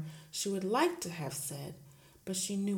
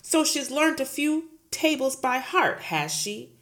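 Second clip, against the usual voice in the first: loud, sneering bark; 8 semitones higher, 10 dB louder than usual. Acted. Headmistress barking at Miss Honey, loud. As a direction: bark it scornfully. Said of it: "Miss Trunchbull barked".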